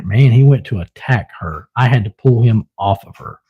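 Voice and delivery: normal talking voice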